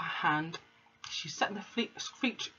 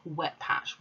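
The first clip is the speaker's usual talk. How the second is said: ordinary delivery, nothing marked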